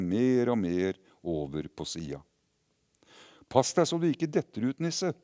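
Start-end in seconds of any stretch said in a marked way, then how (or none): 3.5-5.1 high, loud warning shout; calling out a warning. Acted. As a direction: shout a warning to the little elf. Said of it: "ropte den vesle gutten".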